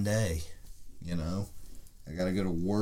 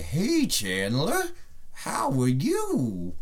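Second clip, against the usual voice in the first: slow, higher shoe puppet voice; 6 semitones higher, slower than usual. Pretend. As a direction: make the shoe talk like a puppet. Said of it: "I'm like (shoe puppet)".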